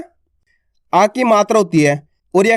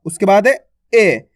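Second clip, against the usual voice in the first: ordinary talk, nothing marked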